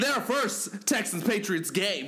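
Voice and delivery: high, loud, hyped host voice; show outro. Acted. Hosts signing off the show to listeners, loud and hyped. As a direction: hype the outro and promo with energy.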